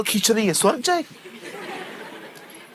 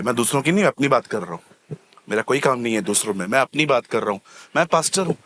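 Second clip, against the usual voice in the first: usual speaking voice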